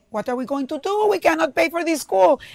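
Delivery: imitated parents' worried plea, pitch climbing; fretting parents. Parents overheard at night about school fees, fretting.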